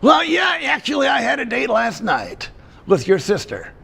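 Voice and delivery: squeaky ventriloquist dummy. Acted. Dummy Pops cracking a joke.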